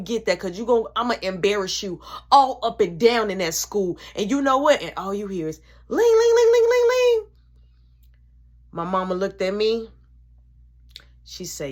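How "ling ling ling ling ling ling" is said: high, loud ringing phone imitation. Imitating the phone ringing, high and loud. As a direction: ring like a phone.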